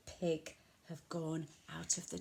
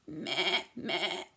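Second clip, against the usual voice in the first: goat bleat; 4 semitones lower than usual. Pretend. Animal sounds for children, low goat bleat. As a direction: lower bleating meh. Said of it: "make the sounds that the animals make".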